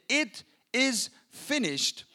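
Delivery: 'It is finished' drawn out slowly; slow last words. Acted. Dying Jesus to the crowd, slow and spent. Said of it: "Jesus said".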